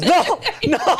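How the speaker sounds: retelling a friend's panic while laughing; laughing mock cry. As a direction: giggle out a fake panic. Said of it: "your man goes".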